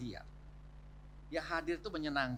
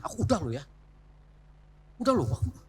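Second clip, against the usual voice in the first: pushy demand; 5 dB louder than usual. Retold or imitated. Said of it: "Jangan paksa suami ya".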